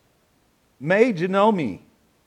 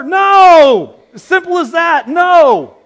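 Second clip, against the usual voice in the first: emphatic no; 10 semitones higher, 10 dB louder, slower than usual. Real, not acted. Emphatic answer to rhetorical question.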